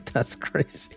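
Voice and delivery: ordinary talk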